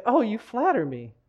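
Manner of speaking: a hint of the flattered teacher, pitch swooping up. Teacher answering the athlete's compliment, lightly acted flattered tone.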